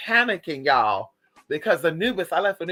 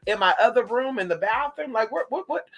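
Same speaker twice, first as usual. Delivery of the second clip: freaked out; 6 semitones higher than usual. Retold or imitated. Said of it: "I was freaked the fuck out".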